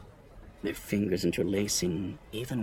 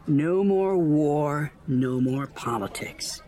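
loud, very slow, stretched-out vowels; drawn-out declaration; 5 semitones higher, 5 dB louder, slower than usual. A narrator's reading. With a wave of her hands, banishing politics, drawn-out declaration. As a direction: stretch each word out grandly.

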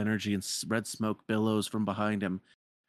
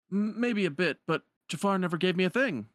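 nervous merchant; 9 semitones higher than usual. Retold or imitated. Merchant voiced in retelling.

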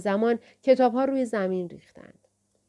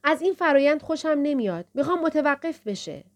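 firm protest in a raised pitch; strong, insistent, higher-pitched demand; firm, forceful voice; 6 semitones higher than usual. A narrator's reading. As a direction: state the objection with power and resolve. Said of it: "با صدایی محکم و پرقدرت".

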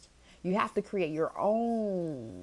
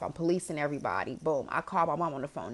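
ordinary talk

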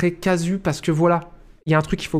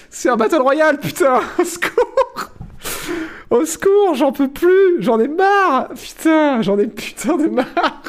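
exasperated laughing; 10 semitones higher, slower than usual. Real, not acted. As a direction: laugh out your frustration. Exasperated at another Battle Royale.

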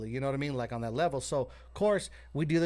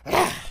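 loud, sudden gulp of air; imitated huge gasp; 10 dB louder than usual. Storyteller showing friend popping up after CPR, loud imitated gasp. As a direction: mimic someone jolting back to life with a gasp. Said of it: "he takes the biggest breath".

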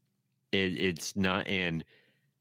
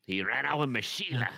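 raised-pitch Australian accent; 4 semitones higher than usual. Shown as a demonstration. Contestant adding an Aussie gag for the panel, Australian accent. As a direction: toss it off as a comic Aussie. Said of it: "sounding like Australian".

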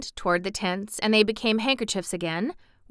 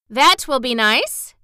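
mildly excited, louder and higher; eager; 5 semitones higher, 8 dB louder, slower than usual. A narrator's reading. Girl welcoming the wizard's plan, eager. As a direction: pipe up eagerly, stretching the words. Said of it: "cried Dorothy eagerly".

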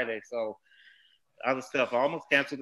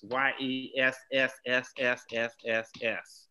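capital letters yes; 4 dB quieter than usual. Real, not acted. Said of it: "Capital letters".

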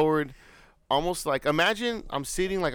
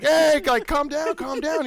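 raised-pitch scolding, starting loud; lightly voiced grandpa; 11 semitones higher, 5 dB louder, slower than usual. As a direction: imitate an exasperated grandpa. Acting grandpa telling kids off.

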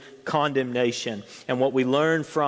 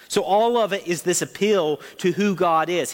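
ordinary talk, nothing marked

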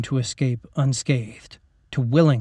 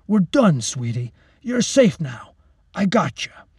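a hint of a comforting dad, raised pitch; fatherly soldier; 4 semitones higher than usual. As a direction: soothe her like a father would. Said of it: "sounded like a dad".

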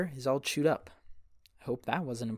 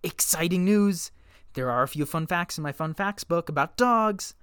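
loud, higher, bright-eyed announcement; excited; 5 semitones higher, 6 dB louder than usual. Acted. Announcing fun facts to the listeners, excited. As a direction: hype up the fun facts like big news. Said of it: "I hope you are half as excited as I am".

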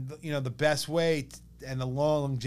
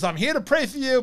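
much higher, louder rabbi offering prayer; high, louder rabbi greeting; rabbi's voice; 10 semitones higher, 6 dB louder than usual. Retold or imitated. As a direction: lift it up like the little rabbi arriving. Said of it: "He goes".

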